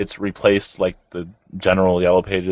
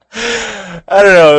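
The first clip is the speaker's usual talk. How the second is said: laughing; 11 semitones higher, 7 dB louder, much slower than usual. Real, not acted. Still laughing after the impression.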